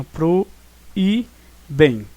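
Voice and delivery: PRO, E, BEM as three separate beats; split into syllables. Shown as a demonstration. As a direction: break the word into "pro... e... bem". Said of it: "porque se separa assim".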